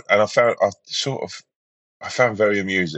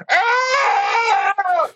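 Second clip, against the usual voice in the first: high sudden yelp, far up; weird scream. Retold or imitated. Drunk passenger screaming at the stewardess, weird high shriek. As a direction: let out a strange strangled scream. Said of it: "He did this weird scream".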